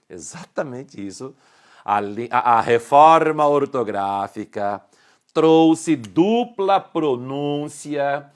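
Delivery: normal talking voice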